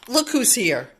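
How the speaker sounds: usual speaking voice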